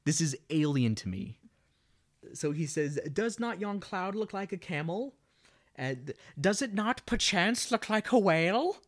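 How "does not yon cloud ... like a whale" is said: lightly theatrical, pitched-up line reading; acted Hamlet quote. Quoting Hamlet's cloud talk in a movie recap, lightly theatrical. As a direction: put on a higher stage voice.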